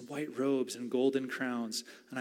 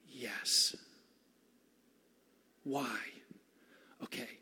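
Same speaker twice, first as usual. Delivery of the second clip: whisper; 2 semitones lower, 6 dB quieter, slower than usual. Pretend.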